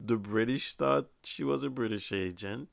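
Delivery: ordinary talk, nothing marked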